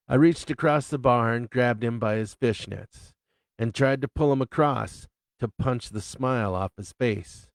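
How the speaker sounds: plain reading voice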